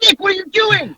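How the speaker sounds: put-on very high, startled call to the kid; high alarmed parent calling out; shocked parent. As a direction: call out to him in disbelief. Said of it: "I was like".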